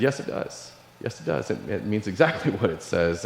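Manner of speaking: softly, almost whispered, calm assurance; quiet whispered yes, gentle and sure; quiet reply. Retold or imitated. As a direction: hush it, calm and kind. Stranger answers calmly, quietly.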